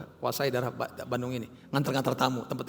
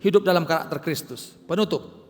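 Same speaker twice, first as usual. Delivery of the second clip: loud, driving sermon delivery, higher and louder; preaching at full force; 5 semitones higher, 7 dB louder than usual. Acted. Pastor preaching loudly to his congregation about bearing fruit. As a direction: hammer the message home to the church.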